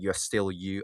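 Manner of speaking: normal talking voice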